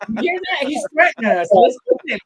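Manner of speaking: high, loud, hammed-up outburst; rally your partner for a fight, playfully overdone; mock fired-up bravado. Acting Chevy Chase's reply to threat.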